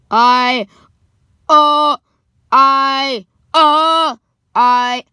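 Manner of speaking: loud, halting stammer with long gaps; stuttering. A narrator's reading. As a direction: stammer each sound out separately, loudly. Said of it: "Luann stuttered".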